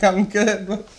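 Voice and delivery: put-on high, laughing weary boy; a hint of a limp kid; sick kid. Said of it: "just lying there like".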